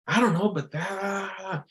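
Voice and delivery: high, slow mock whine; whining girlfriend. Girlfriend fed up with the chewing, high whining voice. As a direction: drag out a woman's whiny complaint. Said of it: "And she says".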